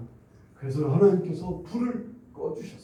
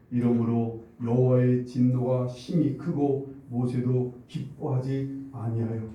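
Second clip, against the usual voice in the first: scripture reading; 6 semitones lower than usual. Acted. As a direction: read the verse solemnly, unhurried. Reads scripture verse.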